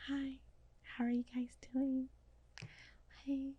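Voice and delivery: very soft, whispery mock-shy greeting; soft-spoken imitation. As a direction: imitate a soft-spoken friend, barely audible. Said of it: "soft spoken as ever".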